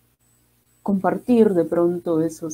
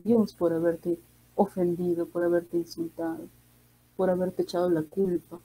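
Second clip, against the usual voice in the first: hushed, softer confession to God; softer prayer; 7 dB quieter than usual. Acted. A mourner asking God's forgiveness, softer and hushed. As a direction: pray it softly as if asking pardon.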